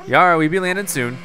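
put-on captain announces landing in a pirate accent. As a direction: call it out like a captain. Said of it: "my best accent".

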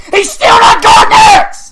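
very high, very loud raging shriek; real hysterical gamer rage; full scream. Real, not acted. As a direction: scream it at the top of your voice. Repeats it as a scream.

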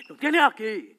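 sudden loud bark; shouted order. Acted. Boyfriend insists phone is right there, shouted order. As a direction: snap into a shout.

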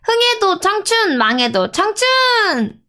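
toast call out. Acted. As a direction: call it out slowly to the room. Calling out a toast.

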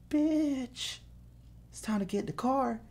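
high, soft, hammed-up bravado; a husband playing tough while whispering it; mock tough husband. Husband bragging to his buddies in the basement, hushed and squeaky. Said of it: "He's all like".

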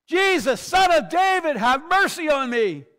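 full-voiced, high desperate shout; loud, high-pitched plea over a crowd; crying out. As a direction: cry out loudly from the roadside for mercy. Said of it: "he starts crying out".

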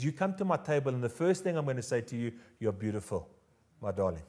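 soft, lowering, gentle words of love; quiet, intimate affirmation; tender reassurance. Acted. Voicing Jesus tenderly to the rejected.

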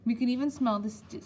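usual speaking voice

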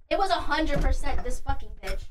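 usual speaking voice

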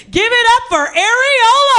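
high, loud hype-man introduction; DJ announcer. Retold or imitated. DJ bringing the comedian on at a show, hyped announcing. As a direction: announce the act to the crowd, big and high. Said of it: "the D J was like".

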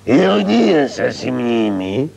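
slow mock solemn. Mock condolence at fake funeral.

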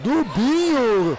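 fast, excited match commentary; play-by-play commentary. Real, not acted. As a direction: call the game live. Live futsal play-by-play.

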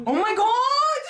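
high shriek through laughter; laughing shriek. Real, not acted. Finding hair in food.

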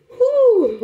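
long high-pitched "whew" of release. Real, not acted. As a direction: let out a high, falling whoop of relief. Overcome after an emotional pep talk.

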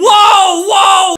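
loud shocked exclamation; shocked whoa. Real, not acted. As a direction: blurt out in loud disbelief. Reacts to outrageous claim.

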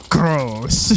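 lightly mimicked Russian accent, drawn from his delivery; imitated Russian-accent "gross". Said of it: "the way he said gross".

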